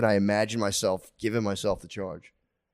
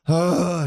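higher mock groan of dismay; exasperated groan; 7 semitones higher, 4 dB louder than usual. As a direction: let out a weary, exasperated sigh. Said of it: "if she wakes up and I go".

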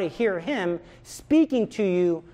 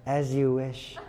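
devoted Wesley; 5 semitones lower, 4 dB quieter, slower than usual. Retold or imitated. Wesley's repeated reply.

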